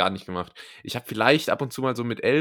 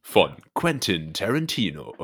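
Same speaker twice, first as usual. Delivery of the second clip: trailer voice; 5 semitones lower than usual. Acted. Joins the trailer bit.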